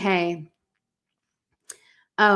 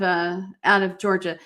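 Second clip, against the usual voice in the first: normal talking voice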